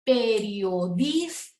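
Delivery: "periodista" drawn out slowly, DIS rising and strong; stressed penultimate syllable. Shown as a demonstration. Teacher pronouncing examples for students, stress on DIS in "periodista". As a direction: stretch "periodista", lift DIS, then drop ta. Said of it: "se pronuncian con más intensidad".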